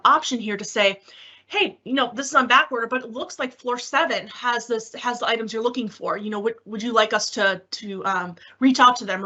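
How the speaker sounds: ordinary delivery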